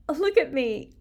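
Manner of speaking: ordinary talk, nothing marked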